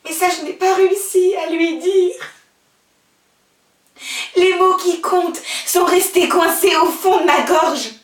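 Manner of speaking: loud, high cry of regret; anguished outburst. Acted. Girl who could not tell Bernard, loud and anguished. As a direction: force it out in anguish. Anguish at words left unsaid.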